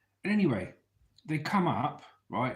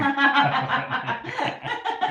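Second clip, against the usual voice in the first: high, loud cracking laugh; high burst of laughter; 6 dB louder than usual. Real, not acted. Laughs at his own bit.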